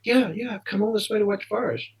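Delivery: high-pitched, eager retold reply; eager confirmation. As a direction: play back a keen young fan confirming it. Eager fan answering the amazed man.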